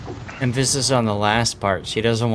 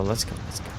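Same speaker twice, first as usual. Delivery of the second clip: breathy whisper, much softer; 5 semitones lower, 11 dB quieter, faster than usual. Real, not acted. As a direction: drop to a hushed whisper. Repeat, then drops to whisper.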